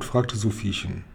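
plain reading voice

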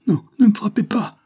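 very high, loud pleading; frightened cry. A narrator's reading. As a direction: cry out to fend off a blow. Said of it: "cria l'enfant effrayé".

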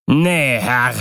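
put-on narrator's restrained cry of a drunken boatswain; called-out refusal. A narrator's reading. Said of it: "rief der Bootsmann".

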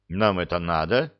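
usual speaking voice

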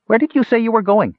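very high-pitched kender question; curious kender. A narrator's reading. Kender asks brightly.

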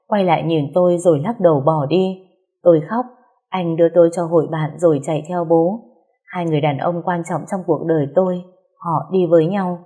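usual speaking voice

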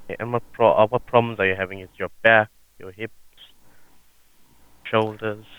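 call center agent. Acted. Prank call, plays sales agent.

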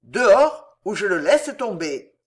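explosive first word, then controlled threat; biting shout. A narrator's reading. Tenant dangling the innkeeper from the window, biting shout. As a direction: shout the order, keep the threat cool. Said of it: "cria-t-il de sa voix calme et mordante".

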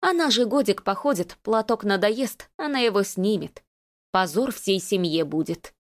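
ordinary delivery, nothing marked

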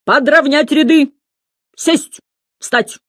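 high, forceful drill commands; thundering orders. A narrator's reading. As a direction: drill the class like a sergeant. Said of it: "гремел Слушкин".

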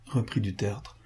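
ordinary delivery, nothing marked

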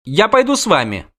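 put-on high-pitched child giving in; little girl's voice. Princess asks to join witcher, high girlish voice. Girl gives in and joins them.

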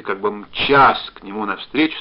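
plain reading voice

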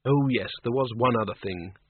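ordinary delivery

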